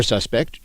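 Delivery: "suspect" as SUS-pekt, start stressed; noun pronunciation. Shown as a demonstration. Teacher offering the alternative word, SUS-pect. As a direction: stress the first syllable of "suspect". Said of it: "the other pronunciation of the word".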